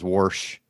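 "warsh" with an intrusive r before sh; family's "warsh". Shown as a demonstration. Quoting how the family talks, "warsh" with an added r. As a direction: sound an r in wash, giving "warsh". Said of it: "all my family says".

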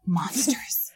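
hushed, eerie whisper; spooky whisper. Pretend. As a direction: breathe it like a ghost story. Spooky legend talk, hushed.

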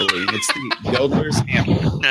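slow, laughing "Yodeler's" said as YO-de-ler's, stress on the first syllable; correct pronunciation. Shown as a demonstration. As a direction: spell out "Yodeler's" slowly, YO-de-ler's, through a laugh. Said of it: "putting the emphasis on the wrong syllable".

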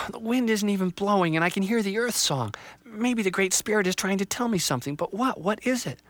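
put-on high, hurt young tree voice; a small tree on the verge of tears; upset little tree. Little Tree left behind, high and dismayed.

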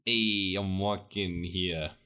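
put-on slow, loud Brooklyn accent; hammy New Yorker voice, drawn out; Christopher Walken impression. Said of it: "Your Christopher Walken is just a Brooklyn accent".

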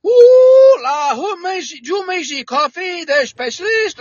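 high, sing-song Cajun voice; Cajun accent. Retold or imitated. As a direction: play the delighted Cajun recruiter, pitched high. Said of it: "well, he was a Cajun".

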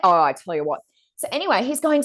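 usual speaking voice

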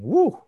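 sudden high, loud "woo"; imitated high woo. Host retelling Felix in the helmet, high loud woo. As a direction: leap up into a whooped woo. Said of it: "and then saying".

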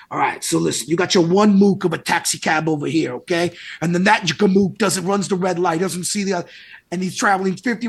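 put-on light Brooklyn accent, teacher explaining; a hint of a Bronx physics teacher; teacher explaining a car crash in a light New York accent. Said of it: "thick Bronx or Brooklyn accent".

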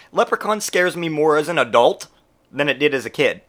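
ordinary talk, nothing marked

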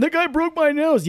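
high-pitched imitation of an aggrieved victim; hammed-up whine of protest; mock complaint. Acts out injured man's complaint.

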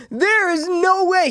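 high, slow incredulous cry; disbelief. Retold or imitated. Watching the Gabriel reveal, high slow disbelief. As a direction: stretch it out in pure disbelief. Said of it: "I'm going".

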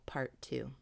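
usual speaking voice

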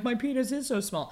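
high squeaky mock voice of a humiliated troll; mock whiny troll. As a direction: squeak it out like a deflated bully. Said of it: "because they're like".

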